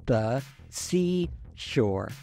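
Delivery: very slow, each word chopped apart with pauses; pen-in-teeth enunciation drill, one word at a time; staccato. Shown as a demonstration. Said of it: "That was staccato".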